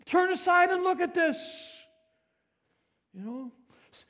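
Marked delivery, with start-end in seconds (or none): 0.0-1.4 excited exclaim. Retold or imitated. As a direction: cry it out like sudden wonder. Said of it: "Why does he suddenly go crazy?".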